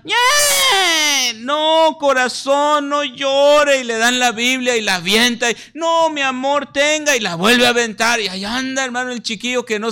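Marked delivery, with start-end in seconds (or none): 0.0-1.3 put-on a sudden high crying shout like a bratty toddler; shrill bratty whine-cry; child's tantrum wail. 1.4-3.8 put-on high coaxing voice, pampering the child; mock-sweet parent pleading with a brat; pleading father. Father soothing tantrum child. 5.8-7.1 put-on high coaxing voice, pampering the child; mock-sweet parent pleading with a brat; pleading father. Father offering Bible to child.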